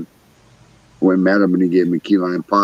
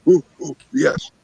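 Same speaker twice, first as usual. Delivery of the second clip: higher, odd-sounding answer; startled stranger; 8 semitones higher than usual. Retold or imitated. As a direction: caught hiding, answer in a funny voice. Crackhead answers from the dark.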